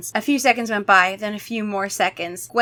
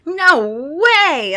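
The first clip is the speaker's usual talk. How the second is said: much higher, loud No way; cry out; 8 semitones higher, 7 dB louder than usual. Acted. [name] dodging the vampire, loud cry. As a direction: yell in protest. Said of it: "I cried".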